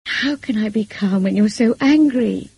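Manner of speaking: distressed, tearful reproach; tearful protest. A narrator's reading. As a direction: protest through tears, pitch lifted. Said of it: "now I let them flow freely".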